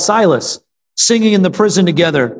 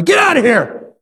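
movie-quote yell; 8 semitones higher than usual. Acted. Preacher jokingly quoting a movie on driving off bad friends, mock yell. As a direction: holler it like a line from a film. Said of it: "yell at them like, what is that movie?".

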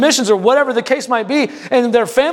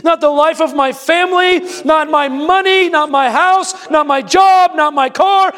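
fervent declaration; 8 semitones higher than usual. Acted. Preacher leads the church in a fervent shouted declaration. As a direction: proclaim it at full force.

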